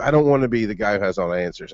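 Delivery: ordinary delivery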